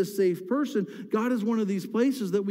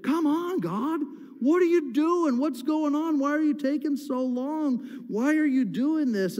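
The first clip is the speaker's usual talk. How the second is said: higher, pressing, exasperated questions; frustrated cry to God; 7 semitones higher than usual. Retold or imitated. Preacher acting a believer shaking his fist at God, exasperated. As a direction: demand answers from God, fed up. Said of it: "shake your fist at him and say".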